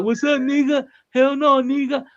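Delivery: high, loud mock outsider voice. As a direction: parody a wannabe saying it. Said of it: "how you think my people feel when y'all".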